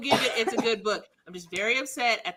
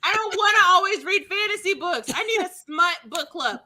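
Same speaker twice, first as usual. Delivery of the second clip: laughing protest; 7 semitones higher than usual. Real, not acted.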